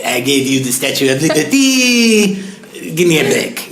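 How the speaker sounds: lightly put-on French accent, laughing through it; a half-hearted French bulldog in a poor French accent; bad French accent. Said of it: "doesn't sound like a good French accent".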